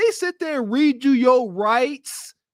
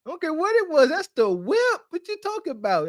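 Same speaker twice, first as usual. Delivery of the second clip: higher mock upset man; upset owner voice; 6 semitones higher than usual. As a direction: play the wounded car owner. Playing the upset car owner.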